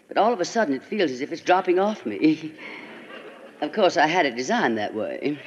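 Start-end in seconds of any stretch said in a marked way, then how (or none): none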